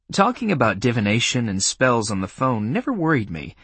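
plain reading voice

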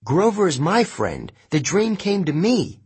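high-pitched, urgent objection; heated, high protest; shouted protest. A narrator's reading. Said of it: "I shouted".

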